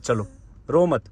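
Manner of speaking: low, hushed comforting; gently calm someone who is crying, voice dropped low; soft, deep reassurance; soothing. Real, not acted. Son soothes his crying mother.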